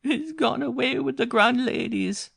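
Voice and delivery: put-on very high, slow, crying child voice; girl crying to her mother; blubbering little girl. A narrator's reading. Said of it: "blubbered the little girl".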